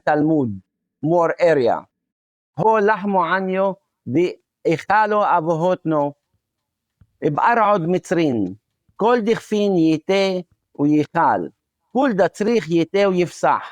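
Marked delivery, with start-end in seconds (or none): 2.6-13.6 brisk Aramaic reading with o endings; Western Aramaic accent. Shown as a demonstration. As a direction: read out in a Galilean Aramaic accent, phrase by phrase. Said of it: "in my accent".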